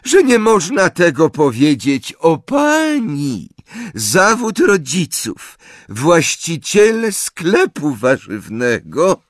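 high, slow character voice. A narrator's reading. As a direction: speak up in a high, dragging voice. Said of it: "dodała głośniej".

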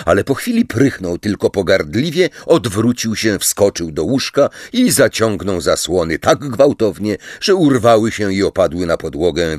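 ordinary delivery

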